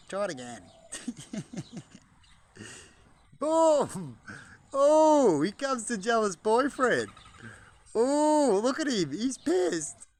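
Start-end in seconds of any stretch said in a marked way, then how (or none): 3.4-4.2 very loud, high burst; sudden delighted shout; excited boom. Real, not acted. Sudden exclamation. 4.7-10.1 high, loud amused commentary; slow, amused play-by-play of the birds; excited narrating. Real, not acted. Narrating rooster jealousy excitedly.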